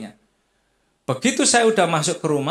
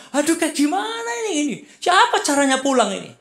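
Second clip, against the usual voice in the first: high, anxious fretting, a touch of mock panic; mildly imitated panicky worry; retold panic; 8 semitones higher than usual. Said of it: "kita ini udah mulai panik nih".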